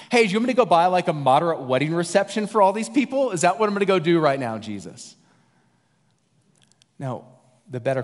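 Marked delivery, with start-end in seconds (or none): 0.1-5.1 higher, louder incredulous disciple protesting; hammed-up disbelief, rushing at the end; mock exasperation. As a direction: disciple scoffing at Jesus, loud and high.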